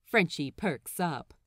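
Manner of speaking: plain reading voice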